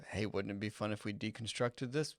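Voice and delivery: ordinary talk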